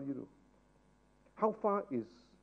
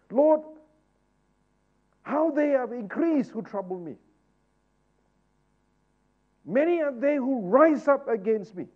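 higher, louder preacher's exhortation; full-force preaching; 9 semitones higher, 10 dB louder than usual. Acted. Preacher exhorting his church to pray, fervent. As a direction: drive the congregation to pray boldly. Psalm read with fervour.

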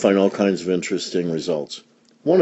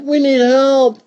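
put-on girl's high holler for help; calling for help; 4 dB louder than usual. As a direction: sing it out high to the dog. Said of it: "the daughter called the dog".

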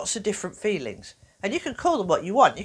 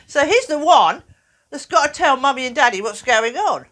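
normal talking voice

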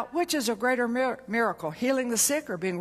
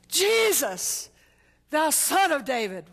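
shouting out; 5 semitones higher, slower than usual. Retold or imitated. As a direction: call out across the crowd. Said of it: "He shouted".

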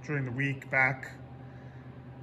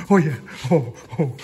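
ordinary delivery

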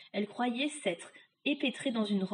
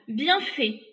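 loud, slightly raised jab; unsympathetic retort; 4 semitones higher, 7 dB louder than usual. Acted. Boy tightening the knot on the witch, loud retort. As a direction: give no pity at all. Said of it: "dit-il en serrant le nœud".

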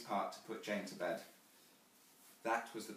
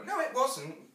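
put-on high child's voice, solemn correction; grave child; 12 semitones higher, 7 dB louder, slower than usual. A narrator's reading. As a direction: correct mother like a serious little girl. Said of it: "Jane said gravely".

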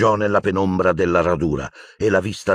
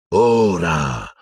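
roared command. Acted. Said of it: "ruggì una voce".

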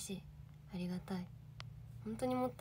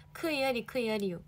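ordinary delivery

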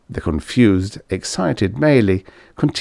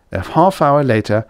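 usual speaking voice

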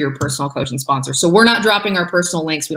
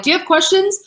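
high, put-on asking voice; imitating attendees' question; 13 semitones higher than usual. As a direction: mimic an attendee in a high-pitched voice.

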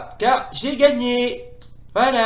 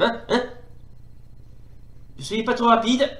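ordinary delivery

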